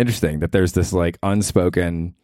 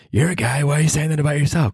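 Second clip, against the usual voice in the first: listener voice; 8 semitones higher than usual. Acted. Imagined listener objection.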